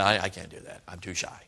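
low, soft, backing away; timid excuse dropping away softly; shy refusal. Retold or imitated. Shy teenager putting off a call to preach, timid and quiet. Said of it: "I was extremely shy".

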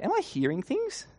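high-pitched disbelieving question; wondering. Acted. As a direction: ask yourself in wonder. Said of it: "You begin to wonder".

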